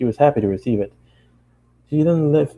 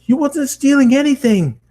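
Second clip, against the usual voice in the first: much higher and louder; yelling; 5 dB louder than usual. Acted. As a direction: shout her name. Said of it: "I yelled".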